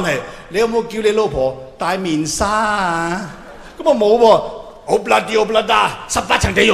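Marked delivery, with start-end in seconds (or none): 3.8-6.7 loud mock verdict.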